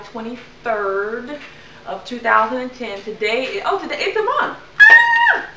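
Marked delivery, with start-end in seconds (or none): 3.7-4.6 excited. Real, not acted. Celebrating one month milestone. 4.8-5.5 very high, loud happy squeal; excited squeal. Real, not acted. Celebrating weight loss milestone.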